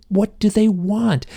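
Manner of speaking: high, drawn-out reenacted plea; anguished plea. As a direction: plead it slowly, pitch way up. Reenacting his anguished hypnosis outburst.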